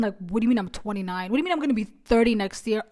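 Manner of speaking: usual speaking voice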